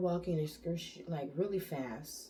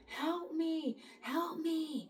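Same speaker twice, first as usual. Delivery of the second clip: high pleading cry for help, imitated; imitating a stranger's cry for help; mock scream for help; 12 semitones higher, much slower than usual. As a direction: imitate a desperate stranger crying out. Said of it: "the person is screaming like".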